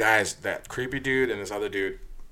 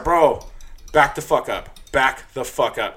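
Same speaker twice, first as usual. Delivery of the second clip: loud, firm warning, higher; retold yell; 4 semitones higher, 7 dB louder, slower than usual. Bouncer yelling at creepy guy to back off, loud. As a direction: bark it at him again.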